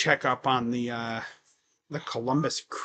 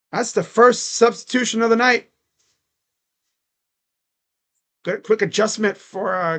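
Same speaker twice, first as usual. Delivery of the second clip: lively courtside commentary, louder; play-by-play; 5 semitones higher, 8 dB louder than usual. Acted. Streamer narrates the Thunder game to chat as play-by-play. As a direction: call each possession as it happens.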